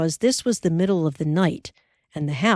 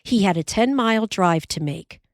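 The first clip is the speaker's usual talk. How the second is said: usual speaking voice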